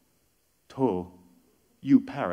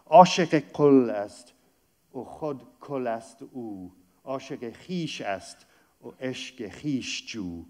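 loud start, then quick, hushed Persian chanting; Persian verse recital; 4 dB louder, faster than usual. Acted. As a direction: recite the mystic couplet.

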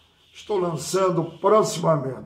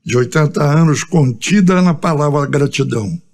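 measured cordel-style recital, phrase by phrase; rhymed monologue recitation; 8 dB louder than usual. Acted. Singer recites his eighty years of life in rhyme, declaimed. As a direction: declaim the verses firmly, pausing after each line.

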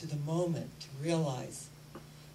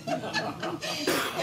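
laugh; 8 semitones higher than usual. Real, not acted. Laughing after daughter quote.

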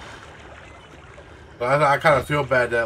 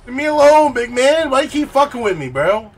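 high panicked pleading; scared yelling; 12 semitones higher, 7 dB louder than usual. Real, not acted. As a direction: yell at the monster in a panic. Chased by horror game monster.

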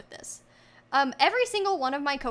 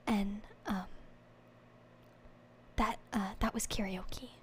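whispering; 7 semitones lower, 9 dB quieter than usual. Real, not acted. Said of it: "I have to whisper for the next hour".

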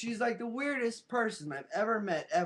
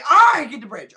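much louder shouted "I get the bridge"; loud outburst; 3 semitones higher, 13 dB louder than usual. Real, not acted. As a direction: blurt it out so the meter hits red. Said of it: "that was really loud".

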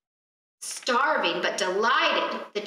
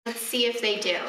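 usual speaking voice

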